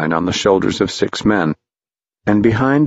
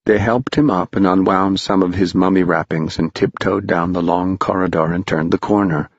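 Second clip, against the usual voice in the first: ordinary delivery, nothing marked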